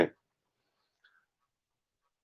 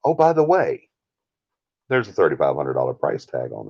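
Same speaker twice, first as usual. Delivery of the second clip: mock casual; 4 semitones lower than usual. Team springing the price on a client, mock casual.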